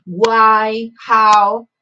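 Why and how said loud and punched; stressed question words. Shown as a demonstration. Teacher punching question words for the class. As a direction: hit "Why" and "how" hard so students catch them. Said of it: "teacher stressing question words".

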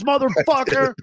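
hammed-up high yell; mock movie cop. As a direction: cap the threat like an over-the-top action hero. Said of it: "trying to play, like, fucking movie cop".

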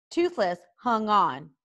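ordinary delivery, nothing marked

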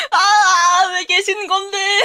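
high laughing cry; laughing shriek. Real, not acted. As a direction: shriek through embarrassed laughter. Embarrassed laughing crying shout.

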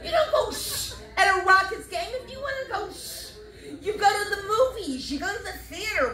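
high-pitched mock scolding; worked-up, incredulous comic rant; indignant retort. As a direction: tell off the shusher in high indignation.

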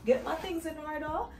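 quiet late-night voice; hushed talking. Real, not acted. Late hotel arrival, hushed.

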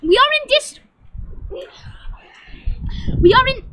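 exasperated loud announcer; comic frustrated host shout; loud host restarts. Pretend. Host shouting over distractions.

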